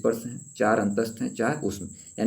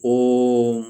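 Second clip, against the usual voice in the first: prolonged vowel; 7 dB louder than usual. Shown as a demonstration. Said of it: "ज़्यादा समय लग रहा है".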